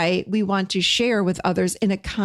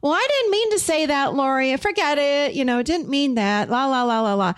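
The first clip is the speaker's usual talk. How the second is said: high-pitched, flustered babble; mock backtracking, trailing into la-la chatter; wishy-washy backpedaling; 6 semitones higher than usual. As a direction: take it all back in a singsong ramble. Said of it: "I'm not saying".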